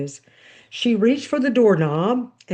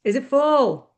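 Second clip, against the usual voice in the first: higher, lightly voiced mom's question; mom over intercom; 5 semitones higher, 4 dB louder than usual. A narrator's reading. Mom answering over the kitchen intercom, lightly acted higher voice. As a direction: pitch it up as Mom checking in. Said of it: "She answers me over the intercom".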